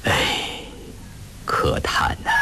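breathy sigh, sinking low; slow lament. A narrator's reading. Wu laments two ruined families, slow sigh. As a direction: sigh it out in sorrowful resignation. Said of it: "徐徐地说".

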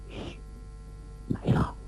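soft, tense shush; quiet heads-up to companions; hushed warning. A narrator's reading. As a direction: hush them, keeping it under your breath. Hushing companions as the enemy approaches.

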